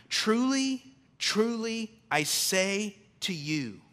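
high, ringing opening on truly; solemn scripture reading. Acted. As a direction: proclaim it like scripture. Reading Jesus' solemn words.

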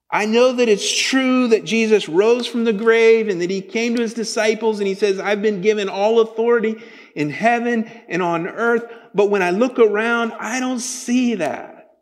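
loud, high, pleading preacher voice; impassioned sermon climb; fervent preaching. Acted. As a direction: press the doubt hard, like a preacher at full force. Preaching at full force.

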